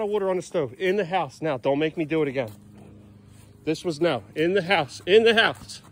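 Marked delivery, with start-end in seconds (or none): 5.1-5.8 firmer command. Real, not acted. Owner telling a stubborn dog to come in, firmer call. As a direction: push the repeated order harder, leaning on "In". Repeats the order more forcefully.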